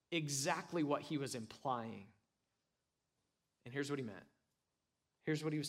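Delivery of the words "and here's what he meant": usual speaking voice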